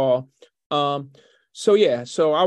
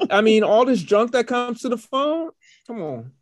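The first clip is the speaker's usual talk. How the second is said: ordinary talk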